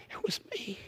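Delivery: whispered, small confession; timid whisper. Retold or imitated. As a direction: breathe it out like a shy woman owning up. Woman confesses timidly to Jesus.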